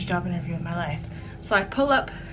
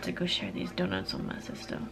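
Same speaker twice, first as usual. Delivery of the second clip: whispering; 9 dB quieter than usual. Real, not acted. Whispering aside to camera.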